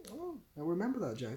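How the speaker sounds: ordinary delivery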